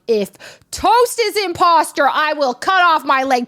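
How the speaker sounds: loud, high mock declaration; swearing an oath. Teammate vouching for Toast, imitated emphatic oath.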